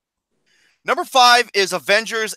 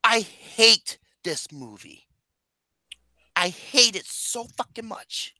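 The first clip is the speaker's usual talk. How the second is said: disgusted; 3 semitones higher, 6 dB quieter, slower than usual. Real, not acted. Hatred of the movie.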